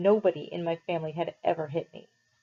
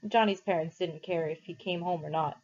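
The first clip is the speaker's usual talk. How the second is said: ordinary delivery, nothing marked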